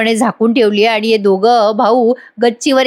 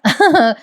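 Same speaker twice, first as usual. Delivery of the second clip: short, higher giggle; brief giggle between story lines; amused chuckle; 4 semitones higher than usual. Real, not acted. Narrator amused by Parth's demands, short giggle.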